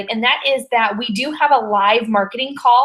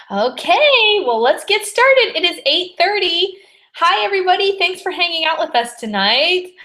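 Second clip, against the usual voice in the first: host welcome; 7 semitones higher than usual. Acted. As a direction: switch on the webinar host voice. Opens the webinar as host.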